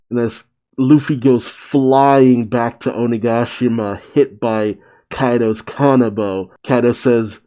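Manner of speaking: ordinary talk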